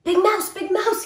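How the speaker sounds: high, loud rising call; little mouse calling about rain inside, high and loud. A narrator's reading. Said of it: "he called".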